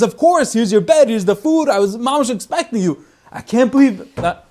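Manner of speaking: high excited hospitality; overjoyed host. Retold or imitated. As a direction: gush with relief at the guests. Overjoyed host welcoming guests.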